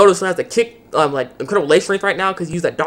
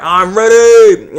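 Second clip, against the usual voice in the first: slow, louder hero boast; hammed-up declaration; 6 semitones higher, 9 dB louder, much slower than usual. Hero announcing his power-up, slow and louder. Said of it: "he's like".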